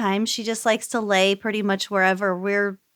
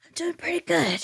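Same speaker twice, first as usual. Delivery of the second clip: whisper; 8 semitones higher, 6 dB quieter than usual. Pretend. Whispered greeting at show start.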